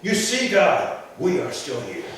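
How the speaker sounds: high, loud defiant cry upward, slowing down; a man shouting at the ceiling of a synagogue; shouting to God. Retold or imitated. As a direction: call up to God that you are still here. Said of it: "and he would shout".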